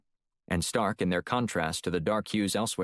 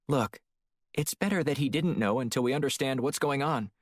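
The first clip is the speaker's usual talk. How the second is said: woman's voice; 6 semitones higher than usual. Acted. Narrator voices female partner Shield.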